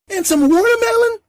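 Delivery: put-on very high, eager kid chatter; little kid voice. The boy greets everyone while offering food, very high and eager. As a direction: bounce it up high like an excited kid.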